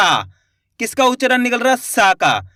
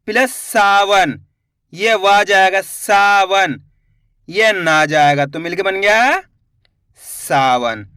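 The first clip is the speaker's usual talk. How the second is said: slow demonstration; slower than usual. Shown as a demonstration. Teacher building the word Sawan for pupils, slow and deliberate. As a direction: stretch Sawan out slowly, syllable by syllable. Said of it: "teacher stretches word in demo".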